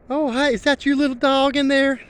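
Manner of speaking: high, friendly lady voice; lightly voiced sweet old lady asking; mimicking a friendly neighbor lady; imitating the lady. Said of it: "And the lady said".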